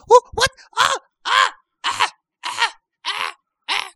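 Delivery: high falsetto yelps, one per stab; hammed-up squeals of a victim; mock stabbing screams. Wife getting stabbed to death, comic falsetto shrieks. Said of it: "Getting stabbed to death".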